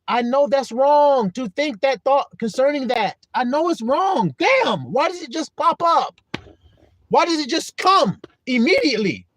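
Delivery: high, loud acted exasperation; anguished frustration. Believer questioning why sinful thoughts pop up, acted anguish. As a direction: cry out against your own thoughts.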